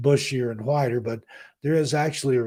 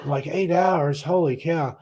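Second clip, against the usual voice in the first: higher, incredulous double take; surprised; 6 semitones higher, slower than usual. Retold or imitated. Recalling the pilot's eight-hour announcement, incredulous. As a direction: react with disbelief at the flight length. Hearing the long flight time.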